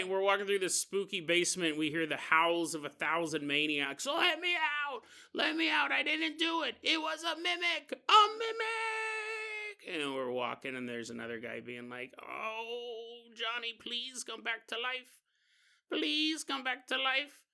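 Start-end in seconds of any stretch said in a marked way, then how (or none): 4.1-10.0 high, desperate cries of a locked-up maniac; frantic inmate. Acted. Caged maniac begging to be let out, high frantic wail. Maniacs howling in the prison basement. 12.2-17.4 high, pleading wail; grieving inmate. Acted. Inmate mourning dead Johnny, high pleading wail.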